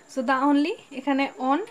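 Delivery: usual speaking voice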